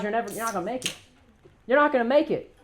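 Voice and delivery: ordinary delivery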